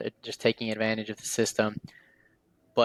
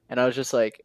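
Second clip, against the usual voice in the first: usual speaking voice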